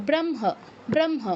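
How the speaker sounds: ব্রহ্ম said with m first and h after, as bromho; correct pronunciation. Shown as a demonstration. Teacher answering his own question, modelling ব্রহ্ম with m before h. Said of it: "তাহলে এটার উচ্চারণটা কী হবে".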